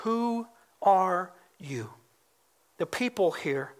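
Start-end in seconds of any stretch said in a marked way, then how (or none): 0.0-1.9 slow, separated words, sinking at the end; solemn questioning. Retold or imitated. Preacher repeats the delegation's question, slow and grave. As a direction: let each word hang, a little lower. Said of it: "they ask a simple question".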